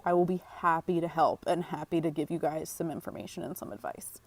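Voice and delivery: usual speaking voice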